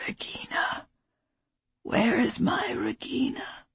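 put-on faint, whispered old man; weak whisper. A narrator's reading. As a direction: breathe the words out faintly. Dying father whispering.

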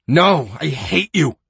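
high, loud spit of hate; spat hatred. Acted. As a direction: fling it at him. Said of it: "she spat".

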